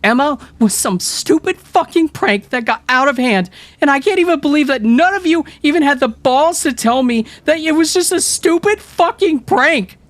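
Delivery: put-on girl near tears raging at friends; tearful furious rant. A narrator's reading. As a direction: cry out the unfairness in rage.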